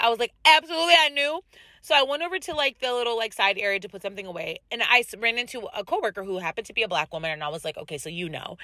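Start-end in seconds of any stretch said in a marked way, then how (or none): 0.4-1.4 high, emphatic acted-out reply; retold emphatic excitement. Acts out her excited answer to client. 7.8-8.6 hushed, low confidential tone; retold confiding aside. Acts out confiding in a coworker.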